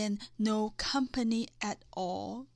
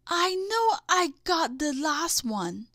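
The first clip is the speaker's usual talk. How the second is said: baffled; 4 semitones higher, 4 dB louder than usual. Acted. Character baffled, voiced.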